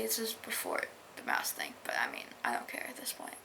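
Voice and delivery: quick hushed whisper; fast whispered aside. Real, not acted. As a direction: whisper it under your breath. Whispered aside about spoilers.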